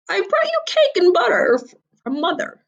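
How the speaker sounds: high squeaky wolf pretending; squeaky fake girl. A narrator's reading. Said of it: "I squeaked".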